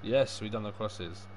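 normal talking voice

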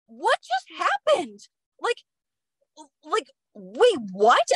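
high, slow retold bewilderment; baffled disbelief. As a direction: replay your stunned confusion, halting between phrases. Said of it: "I was so confused".

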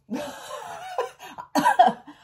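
startled burst of high laughter; high squeal of a laugh; sudden high laugh. Real, not acted. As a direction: laugh out at the slip. Laughing at own mishap.

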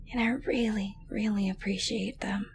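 whisper. Acted. Girlfriend whispering her thanks to her captive boyfriend. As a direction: whisper it, intimate and close.

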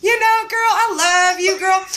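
put-on playful girlfriend banter; excited gushing. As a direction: hype your friend right back. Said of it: "And I was like".

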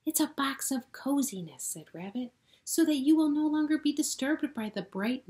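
high, loud and eager; proud explaining. Acted. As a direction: offer it beaming. Rabbit explains gift.

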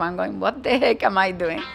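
ordinary delivery

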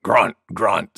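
low boar grunts spoken as words; grunting wild boar. A narrator's reading. Wild Boar joining the sneeze contest, low grunting voice. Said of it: "said Wild Boar".

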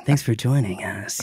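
late night DJ voice. Shown as a demonstration. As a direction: ease it out like a late night radio host. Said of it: "Late night D J voice".

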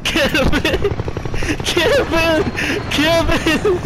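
high, loud frenzied yelling; screaming. Real, not acted. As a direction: scream it in wild excitement. Victory frenzy, screaming at teammate.

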